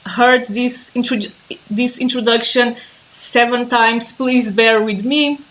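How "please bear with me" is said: ordinary talk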